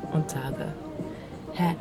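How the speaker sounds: ordinary talk, nothing marked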